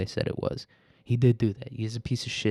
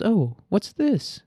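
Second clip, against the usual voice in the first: high, slow put-on curious voice; intrigued surprise; 11 semitones higher, 3 dB louder, much slower than usual. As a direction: act out a curious discovery, pitched way up. Voices the man seeing a message.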